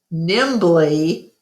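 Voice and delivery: ordinary delivery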